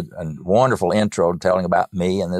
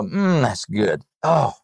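savoring listener; 4 semitones higher, slower than usual. Retold or imitated. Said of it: "Yeah, you know".

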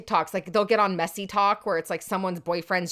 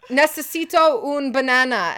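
put-on clumsy American accent on Spanish, higher and louder; gringo Spanish accent; 7 semitones higher, 9 dB louder than usual. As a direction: fumble the Spanish like a proud beginner. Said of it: "We gotta work on your accent".

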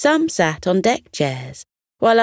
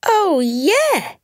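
excited cry; 12 semitones higher than usual. A narrator's reading. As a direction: squeal with delight. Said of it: "she cried".

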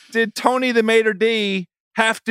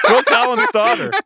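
amused, laughing repeat of the claim; 3 semitones higher than usual. Real, not acted. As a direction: say it through a real laugh. Cracking up at wrong trivia claim.

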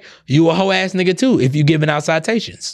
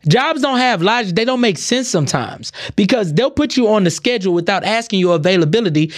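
ordinary talk, nothing marked

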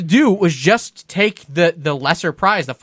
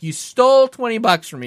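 raised-pitch, forceful accusation; retold heated confrontation; angry accusation; 6 semitones higher than usual. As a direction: confront him, pitched up and indignant. Confronting the carny.

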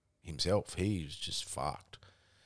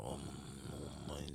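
imitating barely able to talk; 3 semitones lower, 15 dB quieter, much faster than usual. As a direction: mumble helplessly like someone too wasted to speak. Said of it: "what are you trying to say".

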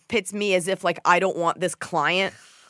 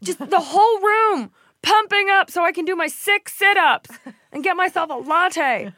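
much higher, ditzy mock voice; mocking the client; 13 semitones higher, 5 dB louder than usual. Mocking an imagined gym client, high ditzy. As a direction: ham up a vapid gym client. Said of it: "your client's like".

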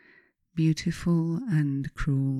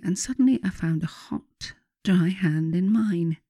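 plain reading voice

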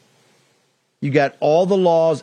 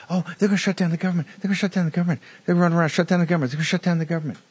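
a lightly voiced fast, childish whine; mock the panicky pundits like little kids, rattling it off; mocking whiny children; 5 semitones higher, much faster than usual. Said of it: "Like little children, they're gonna run around".

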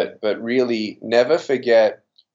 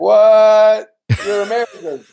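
much higher and louder, mock disbelief; mock shocked cousins; 12 semitones higher, 6 dB louder than usual. Australian cousins teasing him on his visit home, mock shock. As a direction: play the cousins gasping he's gone American. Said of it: "they're like".

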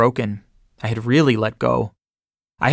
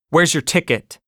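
insistent, higher pushy question; persistent; 5 semitones higher than usual. Retold or imitated. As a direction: keep pressing him for the ticket. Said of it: "I persisted".

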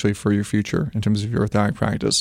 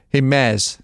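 put-on a light Boston accent on a friendly greeting; Boston impression of Alfred; 3 semitones higher than usual. Imitating Alfred greeting him in a Boston accent. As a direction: call out hello in a jokey Boston voice. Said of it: "I'll be re-impersonating Alfred saying my name".

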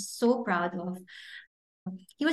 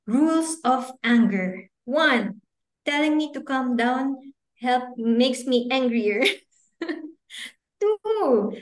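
put-on higher, slower child voice reading his rules; child reading his list; 3 semitones higher, slower than usual. Mother reads her son's anger rules aloud, higher child voice. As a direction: read it out like the little boy wrote it. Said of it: "started writing".

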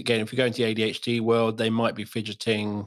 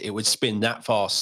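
ordinary delivery

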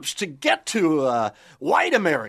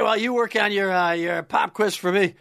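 ordinary talk

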